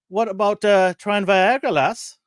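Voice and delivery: a hint of an Irish country doctor. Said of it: "Let me get my Irish accent out".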